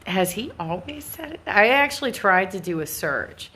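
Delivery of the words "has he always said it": surprised thought. Retold or imitated. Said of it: "And I'm just like".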